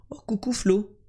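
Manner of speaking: petit salut chuchoté; whispering. Real, not acted. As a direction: chuchote pour ne réveiller personne. Salue en chuchotant la nuit. (salut murmuré)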